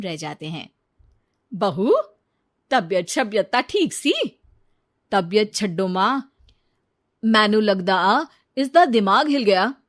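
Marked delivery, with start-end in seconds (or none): 1.5-4.1 louder, puzzled mother-in-law asking; mother-in-law's concern. Acted. Mother-in-law puzzled by her daughter-in-law's change. 5.1-9.7 louder, mocking son to his mother; scoffing husband. Husband telling his mother his wife's gone mad, mocking.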